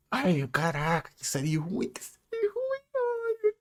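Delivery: soft whimper climbing to a squeak; mock lament. Despairing over the bad series, soft mock whimpering. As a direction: whimper it quietly, ending in falsetto.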